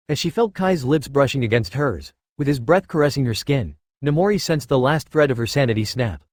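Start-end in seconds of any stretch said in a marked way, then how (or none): none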